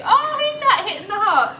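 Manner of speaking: high, loud delighted question; light up at the hearts; excited. Real, not acted. Thanking viewers for hearts, high and loud with delight.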